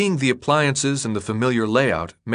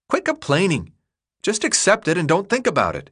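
put-on higher kid voice, dismissive; scolding boy; 8 semitones higher than usual. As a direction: brush off his friend's worries. Chuck scolding Thomas.

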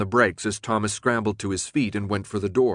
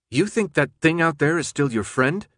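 higher-pitched, cold challenge; 6 semitones higher than usual. A narrator's reading. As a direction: question him with cold scorn. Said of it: "the pilot asked coldly".

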